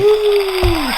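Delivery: very high cheer; excited whoop. Acted. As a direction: whoop like a hyped studio crowd. Guest cheers at his own intro.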